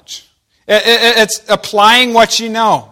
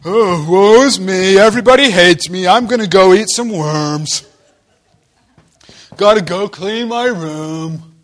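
lightly voiced sulky teen, slow; mock moping teenager; whiny teenager; slower than usual. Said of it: "You're like, the teenager".

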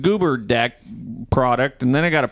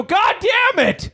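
very high, slow, drawn-out falsetto curse; hammed-up high exasperation; mock wail; slower than usual. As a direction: stretch the curse into a squeal. Retold frustration, third delay.

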